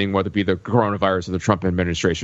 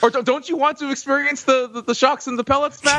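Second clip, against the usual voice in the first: laughing. Real, not acted. Laughing, teasing Matt to join.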